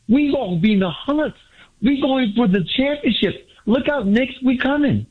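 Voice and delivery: real fan joy, pitch climbing line by line; hyped caller cheering his team on; excited. Real, not acted. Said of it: "You sound so excited".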